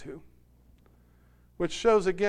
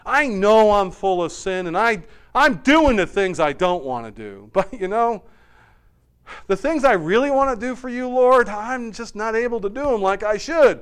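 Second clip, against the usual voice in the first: louder, higher acted frustration; exasperated confession; 6 semitones higher, 7 dB louder than usual. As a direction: confess your failing to God, worked up. Preacher voices Paul's anguished confession.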